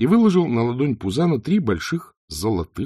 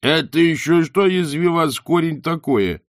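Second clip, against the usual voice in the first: loud, raised, indignant question; raised-voice tavern keeper; gruff innkeeper's challenge; 8 semitones higher, 5 dB louder than usual. A narrator's reading. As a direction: demand it like an affronted landlord. Innkeeper challenges the strange newcomers.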